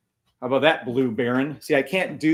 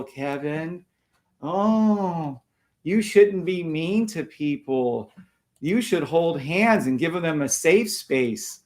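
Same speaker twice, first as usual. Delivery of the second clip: mocking do-gooder; 3 semitones higher, slower than usual. Streamer parodying critics who want him nicer, mocking sing-song. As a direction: stretch it out in a sing-song, patronizing whine.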